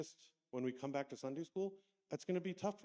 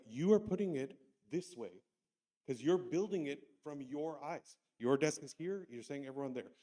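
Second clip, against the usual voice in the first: students explaining; 2 semitones higher, 7 dB louder than usual. Retold or imitated.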